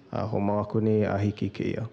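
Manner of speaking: ordinary talk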